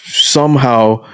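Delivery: normal talking voice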